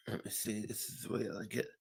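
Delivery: quiet aside under the breath; muttering to self. Real, not acted. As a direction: mumble it half to yourself. Muttering to self while scrolling.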